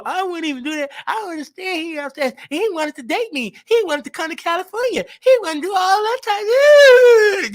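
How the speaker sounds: high, whiny mock crying voice; mocking emotional woman. Mocking Neek as high and whiny, near tears. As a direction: imitate her as tearful and upset. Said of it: "Because she mo".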